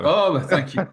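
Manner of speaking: high, laughing oh thank you. Real, not acted. Guest thanks host for the compliment, laughing. Host says he wants to look like him.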